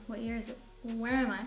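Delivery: usual speaking voice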